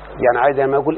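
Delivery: ordinary talk, nothing marked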